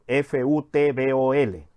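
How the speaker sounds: F U T B O L spelled letter by letter, slowly; spelling out. Shown as a demonstration. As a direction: spell F U T B O L out, one letter at a time. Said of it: "la escribimos fútbol".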